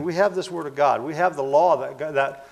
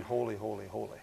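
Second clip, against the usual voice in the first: hushed holy; 4 semitones lower, 10 dB quieter than usual. Acted. Angels' cry quoted softly.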